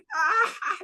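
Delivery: high burst of hearty laughter. Acted. The Baron cracking up at the joke, high laugh. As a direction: let out a high, delighted laugh. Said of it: "He starts laughing".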